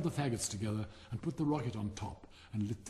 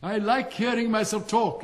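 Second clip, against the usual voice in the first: laughing through the words, higher, louder and slow; self-delighted laugh; 10 semitones higher, 10 dB louder than usual. Acted. Vain Rocket laughing as he boasts about talking. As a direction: chuckle while admiring yourself.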